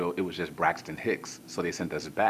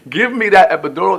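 demanding yell; 13 semitones higher, 18 dB louder than usual. Retold or imitated. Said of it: "She told them".

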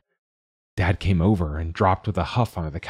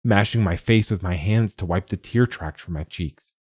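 ordinary delivery, nothing marked

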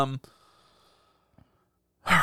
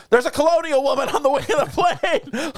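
high, frantic airplane panic voice, much higher; mock panicked Kristen Wiig; 10 semitones higher than usual. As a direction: squeal it out like she's losing her mind on the plane. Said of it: "all the way through".

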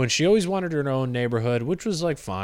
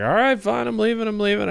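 much higher, placating voice; meek retreat; 9 semitones higher, faster than usual. Retold or imitated. Canvasser retreating from the yelling girl, high and meek. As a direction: back away with a sheepish, high give-in. Backing off from angry teen.

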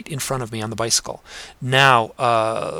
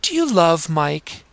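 put-on high, slow, insistent little girl's voice, louder on the repeat; a cute young girl pestering her mom; childlike voice asking it twice, the second time higher and louder; 7 semitones higher, 4 dB louder, slower than usual. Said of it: "turned to her mom and said".

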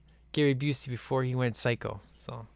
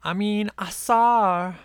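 mimicking movie line; 7 semitones higher, 6 dB louder, slower than usual. Quoting movie girl line.

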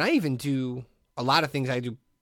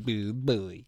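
1 Droopy voice; 5 semitones lower, 6 dB quieter than usual. Retold or imitated. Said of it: "he's standing there going".

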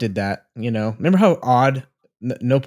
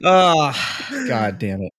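teary from laughing; much slower than usual. Real, not acted. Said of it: "my eyes are watering".

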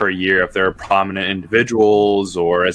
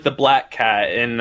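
usual speaking voice

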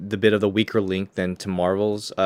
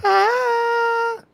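very high, sustained wailing "ah"; imitated song wail; 4 dB louder than usual. Co-host imitating the Amazon theme for a joke, piercing high wail. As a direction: let out a high mock battle-cry wail. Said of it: "more the immigrant song from Girl".

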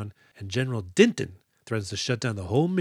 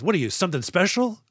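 sneering bark, higher pitched; harsh barking commands; 7 semitones higher than usual. Retold or imitated. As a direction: snap it at a lagging recruit. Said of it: "a phys ed coach can yell at".

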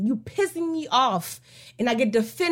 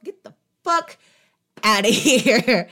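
mock kiss-off; 5 dB louder than usual. Telling the leech to leave.

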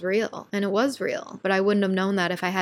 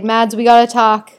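loud, eager call to her friend; urgent call-out; 9 dB louder than usual. Retold or imitated. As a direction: grab her friend's attention, buzzing with energy. Calling her friend after workshop.

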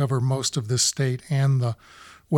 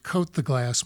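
ordinary talk, nothing marked

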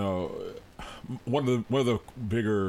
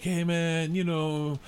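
easygoing mock stoner greeting, pitched higher; higher, relaxed put-on voice; calm laid-back guy; 6 semitones higher, slower than usual. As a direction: lightly imitate a chilled-out guy. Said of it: "he's all calm. He's like".

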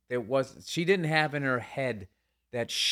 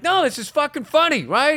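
high and loud insistent plea; desperate pleading; 7 dB louder than usual. Retold or imitated. Comic on stage pleading with the crowd, high and loud. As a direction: beg the crowd to laugh.

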